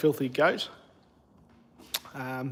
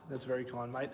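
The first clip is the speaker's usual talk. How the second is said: softer echo of the thanks; soft repeat; 4 semitones lower, 15 dB quieter than usual. Real, not acted. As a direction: repeat the thanks under your breath, much softer. Thanking a viewer, then repeating.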